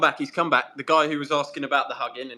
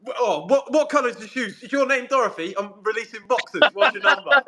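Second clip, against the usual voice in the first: high-pitched teasing imitation of Chris; hammed-up high voice, ending louder; mock confusing chat-up; 9 semitones higher, 4 dB louder than usual. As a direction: fire off questions in a high, laughing parody. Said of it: "Chris just confuses the fuck out of them".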